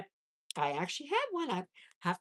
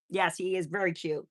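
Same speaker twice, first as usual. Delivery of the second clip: ordinary talk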